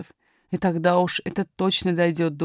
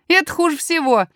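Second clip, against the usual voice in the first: higher, urgent lad's voice; pitched-up, emphatic little miner; boy miner warning; 8 semitones higher, 4 dB louder than usual. Acted. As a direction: warn them firmly as a young boy.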